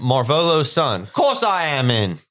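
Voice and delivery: a hint of the drunk uncle; lightly hammed-up yokel; mild rough Morphin. Morphin voiced in reading.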